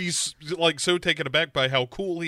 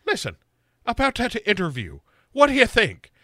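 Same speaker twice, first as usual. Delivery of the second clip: imitating Sarah's accent; 6 semitones higher than usual. Sarah pressing the killer about the interview, high mock-Irish voice. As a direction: lift it into hammed-up Irish by the question. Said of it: "And she's like".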